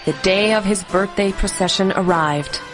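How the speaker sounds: ordinary delivery, nothing marked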